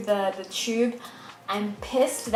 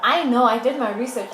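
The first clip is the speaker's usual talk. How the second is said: insistent and loud; raised voice; 3 semitones higher, 7 dB louder than usual. Retold or imitated. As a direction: push back firmly, louder. Arguing with the lying nurse.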